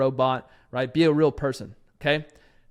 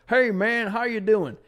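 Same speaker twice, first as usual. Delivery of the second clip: lightly higher country drawl; southern greeting; 6 semitones higher, slower than usual. Retold or imitated. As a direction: greet him like a Tennessee local. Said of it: "they'll be like".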